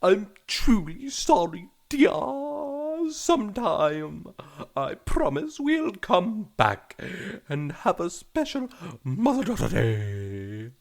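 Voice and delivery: gentle British mother. Acted. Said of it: "a female doing a British accent".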